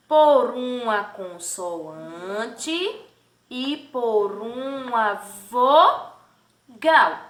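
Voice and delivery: very slow, "consoante" stretched, "vogal" split as vo-gal; drawn-out answer. Shown as a demonstration. Teacher answering who forms the syllable, "consoante" and "vogal" drawn out. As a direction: hold "consoante", then break "vogal" in two.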